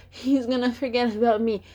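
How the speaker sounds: lightly acted fretting, a little high; a touch of high plaintive worry; mock worried whine. Voicing her own anxious thought.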